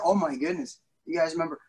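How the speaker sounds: ordinary delivery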